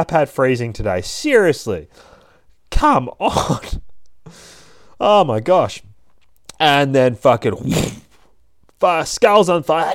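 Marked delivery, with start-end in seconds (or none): none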